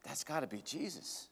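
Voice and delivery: a stunned half-whisper of recognition; awed hush. Acted. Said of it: "John looks up at him and says".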